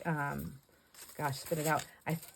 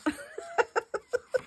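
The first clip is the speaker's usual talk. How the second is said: high giggle. Real, not acted. Teasing husband in retold scene.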